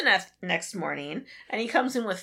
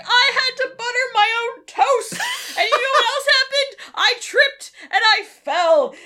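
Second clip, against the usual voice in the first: higher, louder, half-crying complaint; mock sobbing rant; 11 semitones higher, 5 dB louder than usual. Veronica whining to Smithers about her hardships, mock sobbing. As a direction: wail it like a spoiled rich girl.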